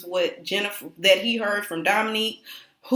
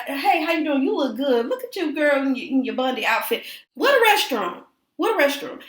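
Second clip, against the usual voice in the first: higher-pitched, chatty small talk; imitated breezy deflection; 5 semitones higher than usual. Jackie changing the subject when confronted by Malaysia, mock breezy. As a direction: dodge the question with chirpy compliments. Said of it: "change the energy, talk about something else".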